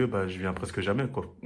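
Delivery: ordinary talk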